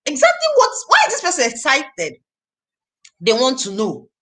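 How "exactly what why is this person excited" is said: curious surprise. Retold or imitated. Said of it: "they'll be like".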